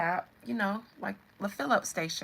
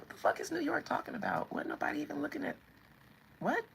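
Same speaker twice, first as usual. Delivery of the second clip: higher, puzzled bystander voice; baffled bystander; 7 semitones higher, 3 dB quieter, faster than usual. Acted. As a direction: ask it in bewildered disbelief. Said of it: "And everybody be like".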